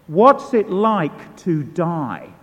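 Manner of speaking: slow and weighty, each word leaned on; slow, heavy question. Retold or imitated. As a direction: let the question land slowly, pressing on every word. Preacher repeats his blurted question.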